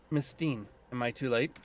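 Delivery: normal talking voice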